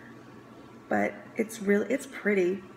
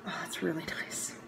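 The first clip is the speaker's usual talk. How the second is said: 1 whispered aside; 2 semitones lower, 9 dB quieter than usual. Real, not acted. Whispered reaction to a scent.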